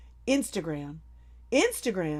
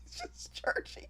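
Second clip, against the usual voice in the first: whispered aside; 12 dB quieter than usual. Real, not acted.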